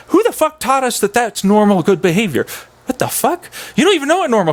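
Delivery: high, incredulous challenge; mock outrage. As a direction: demand answers in high-pitched indignation. Mock outrage at social norms.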